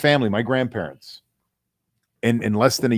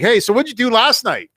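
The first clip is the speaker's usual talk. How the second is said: much higher-pitched self-imitation; random eager question; 9 semitones higher, 4 dB louder than usual. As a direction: pipe up high to butt into the conversation. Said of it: "throw out some random question".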